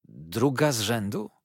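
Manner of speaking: plain reading voice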